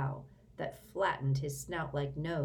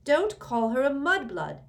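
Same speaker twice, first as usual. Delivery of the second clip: loud, higher angry retort; 9 semitones higher, 9 dB louder than usual. A narrator's reading. Ron and Ginny angrily defend Hermione, loud and sharp. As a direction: snap it back hard in outrage. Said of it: "very angrily".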